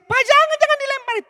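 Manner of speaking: put-on very high, loud scream of a child; shrieking little boy pleading. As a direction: beg dad to stop throwing. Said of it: "Anaknya mulai teriak".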